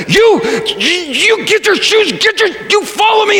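high exasperated shout; snapping angrily. Retold or imitated. Fed-up dad ordering kids to the car, angry shout. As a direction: bark orders at the kids, at the end of your rope. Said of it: "and I just snapped".

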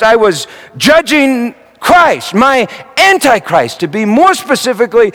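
loud, high, driving sermon delivery; fiery preaching. Acted. Preacher confessing shared anger to the congregation, full-force preaching. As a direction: preach it at full force, pressing each "angry".

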